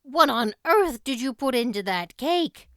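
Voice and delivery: dismayed demand. Acted. Marilla demands about the cake.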